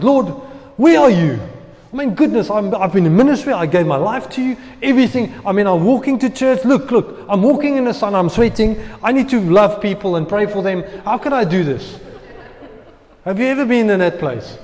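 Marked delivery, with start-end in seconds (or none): 0.0-12.0 louder, higher angry venting at God; complaining to God. Retold or imitated. Preacher retelling his rant to God, louder and higher. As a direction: vent hot frustration at the Lord. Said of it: "I was like".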